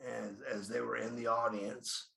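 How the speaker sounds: ordinary talk, nothing marked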